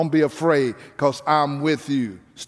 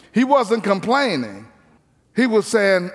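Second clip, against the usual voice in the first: ordinary talk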